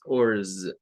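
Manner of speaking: "ors" as a relaxed schwa plus r and buzzed s; "ors" drawn out, ending in a z sound. Shown as a demonstration. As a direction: model "ors" slowly as an unstressed ending. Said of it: "suena con un schwa".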